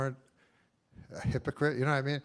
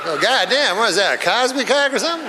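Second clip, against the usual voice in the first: mock shock; 8 semitones higher, 12 dB louder, faster than usual. Woman waking up drugged.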